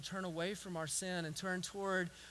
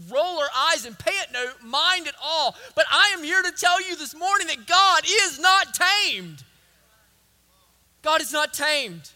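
raised, loud preacher's proclamation; full-force preaching; 10 semitones higher, 15 dB louder than usual. Real, not acted. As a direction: build to a ringing declaration from the pulpit.

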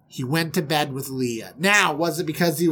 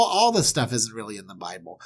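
ordinary talk

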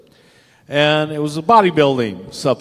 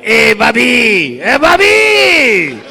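high, drawn-out holler, climbing higher; calling out; 7 semitones higher, 8 dB louder, much slower than usual. Retold or imitated. Midget and lumberjack calling Bobby down the hall, drawn-out holler. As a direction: holler it down a long hallway.

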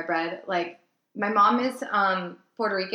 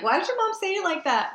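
high mocking boyfriend; 12 semitones higher, 3 dB louder than usual. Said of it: "He's like".

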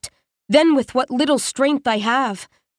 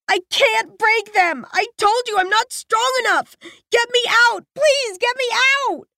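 raw terror; 11 semitones higher than usual. A narrator's reading. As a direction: scream for rescue in pure terror. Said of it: "the raw terror carried on her words".

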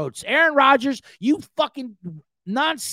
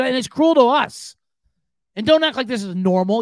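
ordinary talk, nothing marked

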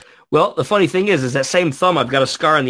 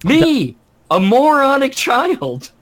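high mock self-mockery; 11 semitones higher, 4 dB louder, much slower than usual. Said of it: "I'm like".

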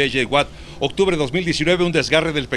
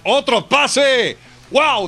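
excited shout over the play; touchdown call; 8 semitones higher, 5 dB louder, much slower than usual. Real, not acted. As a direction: call the catch with a high, loud burst.

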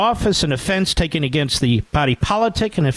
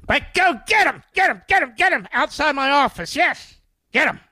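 much higher, squeaky mock cheerleading; mock cheering; 10 semitones higher than usual. Boss sending workers off to fight elsewhere, high mock cheering. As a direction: cheer them on like a cartoon hypocrite.

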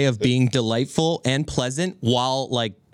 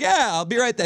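tag; 8 semitones higher than usual. Retold or imitated. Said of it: "he's always nice, but he never listens".

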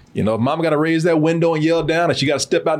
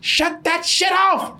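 high mock yell, drawn out; imitating mom yelling; 13 semitones higher, slower than usual. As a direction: bellow like a mom at the window. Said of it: "the sound of my mom going".

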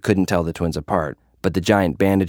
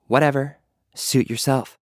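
higher, dismissive brush-off; breezy, higher-pitched put-on nonchalance; fake cool shrug; 7 semitones higher than usual. A narrator's reading. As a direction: toss it off like you don't care. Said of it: "fronting her cool vibe".

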